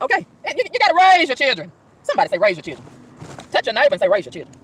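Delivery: preacher's call and response, rising to loud; mock preaching. Driver preaching to herself about parenting, mock sermon. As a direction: preach it to an imagined congregation.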